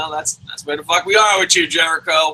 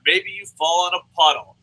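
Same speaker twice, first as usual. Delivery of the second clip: ordinary talk, nothing marked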